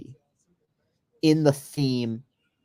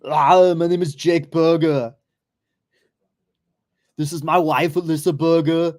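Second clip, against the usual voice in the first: put-on a thick Austrian accent, pitched up, a loud "Ah" to open; Schwarzenegger Austrian accent; 4 semitones higher, 3 dB louder than usual. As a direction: introduce the whole family in a heavy Austrian accent. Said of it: "this, like, fucking six five Austrian".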